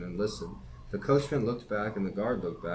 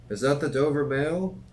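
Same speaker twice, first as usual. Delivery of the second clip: raised, higher calling voice; calling from afar; 6 semitones higher, 6 dB louder than usual. A narrator's reading. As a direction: call out a question through the mist. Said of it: "a man's voice called from the mist".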